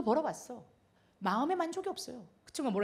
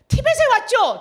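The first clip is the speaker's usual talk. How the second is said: much higher and louder question; a caller on the phone, higher and louder; caller's question; 11 semitones higher, 14 dB louder than usual. Retold or imitated. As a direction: press eagerly for the memory. North Korean caller's question.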